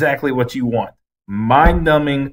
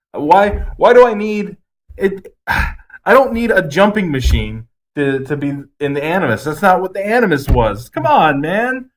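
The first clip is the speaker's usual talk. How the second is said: higher, louder exasperated complaint; exasperated rant; 7 semitones higher, 4 dB louder than usual. Real, not acted. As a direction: vent your frustration at the screen. Ranting about the Animus.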